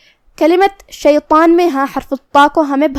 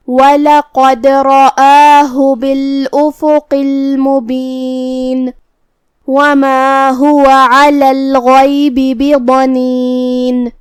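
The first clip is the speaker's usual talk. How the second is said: drawn-out melodic tajweed chant; slow Quran recitation; 3 dB louder, much slower than usual. Shown as a demonstration. A teacher reciting the verse for students, slow melodic chant. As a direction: recite the verse slowly with full elongations.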